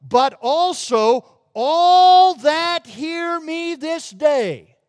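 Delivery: slow, rising fervent plea; Paul pleading with King Agrippa, fervent; voicing Paul's plea. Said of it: "and he says to him".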